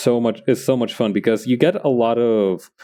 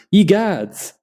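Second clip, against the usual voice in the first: higher-pitched theatrical cry of alarm; mock yelled exclamation; 10 semitones higher, 3 dB louder than usual. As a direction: cry it out like an astonished bystander. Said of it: "go out and go yelling out".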